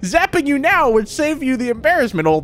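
half-hearted villain read, dropping at the end; Plankton. Acted. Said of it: "I can't do a Plankton voice".

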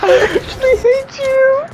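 very high squealed exasperation; tweaking out. Real, not acted. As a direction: squeal it, completely overwrought. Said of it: "I don't know what he's tweaking about".

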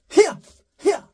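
high 히야 pushed forward; pushed wrong accent. Shown as a demonstration. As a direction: shove the note like Superman. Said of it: "이게 아니라".

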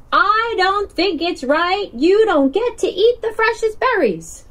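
high, small earnest voice; little turtle voice. Acted. Littlest turtle telling biggest turtle it isn't right, high small voice.

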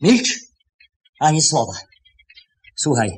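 stern command. Acted. Employer silencing the thief, stern and sharp. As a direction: cut him off hard.

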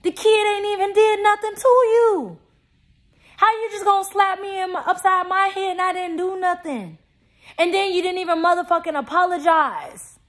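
high-pitched, heated protest; indignant outburst. Real, not acted. Indignantly defends the slapped child.

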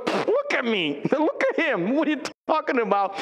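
Sarah laughing off the promise, mocking. Sarah scoffs at God's promise, laughing. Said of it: "She goes".

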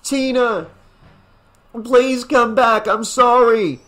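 high, loud, pleading call. A narrator's reading. Father at dock's edge to daughter, pleading call. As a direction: call across the water, begging. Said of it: "called out to her".

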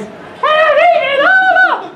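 very high, loud squeak; piercing falsetto baby bear; wee little baby bear voice. Acted. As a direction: squeal it like an outraged tiny bear. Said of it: "in his wee little voice".

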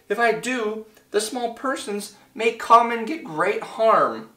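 high, anxious storybook worry; alarmed. A narrator's reading. Horton fears for the small persons, alarmed. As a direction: pitch it up with mild alarm. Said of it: "Horton thought with alarm".

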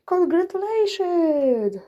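high, slow, drawn-out mock cheer; caller's shouted congratulations. Mimicking a caller's greeting in front of his family, high and drawn out. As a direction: stretch it out high like the excited caller. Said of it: "Krzyczy, że".

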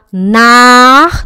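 "nar" as a loud, held "naaach"; wrong long A. Shown as a demonstration. Teacher shows the error, "nar" with overlong A. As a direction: stretch the vowel of "nar" far too long. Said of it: "não é alongo".

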